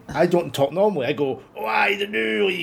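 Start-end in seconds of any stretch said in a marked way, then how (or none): 1.5-2.6 high, hammed-up Scottish voice; Scottish it right up; exaggerated Scottish accent. Shown as a demonstration. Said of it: "asks me to put on a Scottish accent".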